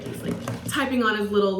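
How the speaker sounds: ordinary talk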